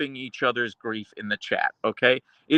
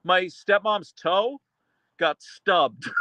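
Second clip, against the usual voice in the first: higher, slow, hammed-up tragic tone breaking into a laugh; mock grief; 6 semitones higher, much slower than usual. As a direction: deliver the anticlimax like a tragedy. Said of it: "someone's like".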